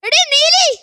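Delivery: high-pitched call to the wife. Acted. Dracula husband calls his wife for blood tea, calling out.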